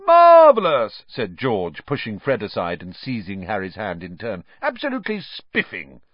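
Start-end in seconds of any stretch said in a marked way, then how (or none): 0.0-1.1 loud, high, drawn-out rapture; hammed-up society delight; mock-pompous gushing. A narrator's reading. George seizing Harry's hand in turn, mock-pompous gushing. 4.6-6.0 high-pitched, hammed-up society enthusiasm; mock-pompous delight. A narrator's reading. George pushing Fred aside to greet Harry, mock-pompous delight.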